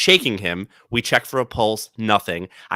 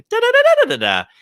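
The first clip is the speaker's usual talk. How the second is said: sing-song therapy voice; 6 dB louder, much faster than usual. Shown as a demonstration. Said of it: "It's a lot more like".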